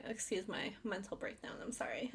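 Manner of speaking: sheepish whispered apology; hushed apology. Real, not acted. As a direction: whisper it, a bit embarrassed. Apologizing for breakdown.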